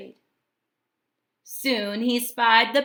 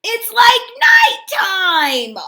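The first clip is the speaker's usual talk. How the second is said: high, loud, slow cry; 11 semitones higher, 12 dB louder, slower than usual. A narrator's reading. The sea urchin cries out about the darkness, high and loud. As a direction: cry out high into the dark water. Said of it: "cried the sea urchin".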